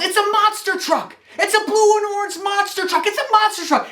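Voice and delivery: put-on very high-pitched little boy yelling with glee; excited toddler yell. Toddler nephew unwrapping a monster truck on Christmas, excited high yell. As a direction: squeal it out like a thrilled toddler. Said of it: "and he yells".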